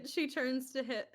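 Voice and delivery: usual speaking voice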